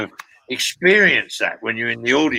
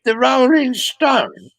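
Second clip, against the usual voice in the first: put-on cheeky teen; slower than usual. Teenage son mocks dad's invitation, slow cheeky drawl. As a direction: sneer at dad's old band. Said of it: "cheeky bastard, he goes".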